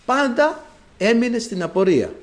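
ordinary talk, nothing marked

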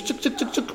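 high, soft clicking "tsouk"; quick repeated ratchet sound made by mouth; imitated unscrewing noise. Preacher shows how the ointment freed him, imitated unscrewing noise. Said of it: "σαν να με ξεβίδωσες".

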